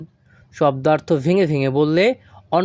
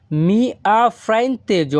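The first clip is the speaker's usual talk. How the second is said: very slow Italian phrase, stress on ha; slow Italian model; 5 semitones higher, much slower than usual. Shown as a demonstration. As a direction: draw each syllable out for learners. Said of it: "তাহলে Italian ভাষা".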